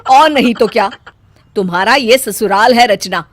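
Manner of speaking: loud, cutting rebuke; harsh mother-in-law reprimand. Acted. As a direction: put her in her place.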